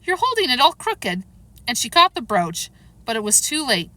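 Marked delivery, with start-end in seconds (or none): 0.0-1.2 high-pitched anxious warning; crying out. A narrator's reading. Alice sees the brooch held crooked, crying out. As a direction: warn urgently in a higher voice. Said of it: "cried Alice".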